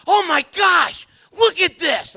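high, loud, slow amazed shout; excited exclaim. Retold or imitated. Wife showing her husband the Bible passage, loud amazed exclaiming.

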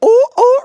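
high, loud whoops; two hammed-up raise-the-roof whoops; party whoops. Player raising the roof, high playful whooping. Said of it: "if you're just like".

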